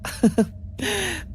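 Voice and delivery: high, light laugh; amused laugh. Acted. Nurse laughing at her stubborn patient, amused. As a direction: let out a soft high chuckle.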